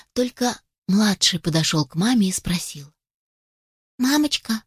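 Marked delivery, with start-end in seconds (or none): 4.0-4.6 tender little chick. Acted. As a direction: ask gently like a loving child. Youngest gently asks mother.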